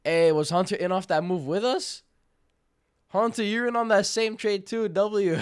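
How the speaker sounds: high, loud, delighted shout-out; excited calling out. Real, not acted. Streamer hailing a trader on the same trade, excited calling out. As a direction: call out loudly to a fellow trader.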